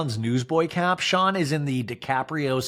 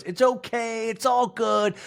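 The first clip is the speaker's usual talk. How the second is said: much higher, sing-song reassurance; mock soothing; 8 semitones higher, slower than usual. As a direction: chirp a hollow reassurance. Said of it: "And Angie K goes".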